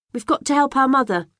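high, prim and very proper; best polite voice. A narrator's reading. Girl refusing the neighbour's tea in her best, prim voice. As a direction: put on your most polite manners. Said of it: "I say".

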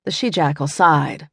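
plain reading voice

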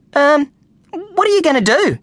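put-on hesitant kid asking a stranger; boy's voice. A narrator's reading. Brian voiced as boy.

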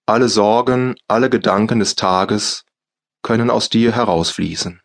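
ordinary talk

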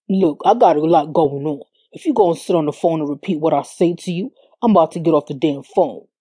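put-on low, irritated drunk man; slurred. A narrator's reading. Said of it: "he said with slurred words".

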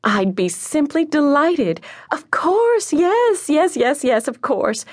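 high, delighted phone chirp; happy chirping. A narrator's reading. Mother accepting a luncheon invitation, happy chirping. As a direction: gush with delight, pitch leaping up. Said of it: "She chirped happily".

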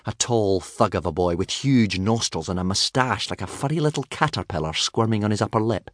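plain reading voice

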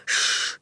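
unvoiced hushing "shh"; urgent shush. A narrator's reading. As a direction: hush him with a hiss. Boy hushes his brother.

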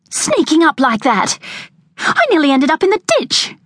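high, angry, out of breath; breathless fury. A narrator's reading. Veronica, breathless after the wild ride, accusing Jack. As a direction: accuse him, still panting. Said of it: "her breath coming in short gasps from exertion or fury".

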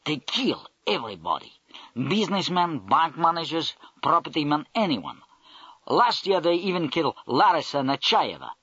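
a lightly indignant Russian voice; indignant Russian accent. Acted. The Russian major, twisting round in his seat, grumbles indignantly in a Russian accent. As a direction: complain about the mafia in a touch-indignant Russian accent. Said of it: "he instantly became indignant".

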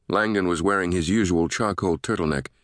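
usual speaking voice

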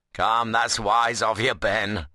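plain reading voice

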